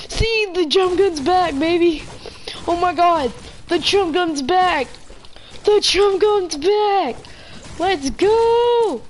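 gloating happily at the screen; thrilled cheer; excited celebration. Real, not acted. Celebrating gun return.

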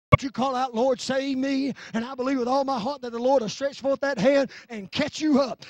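loud, surging sermon delivery; full-force preaching. Real, not acted. Preacher urging folks to call out to the Lord, full-force preaching. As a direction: drive it out in long, shouted phrases.

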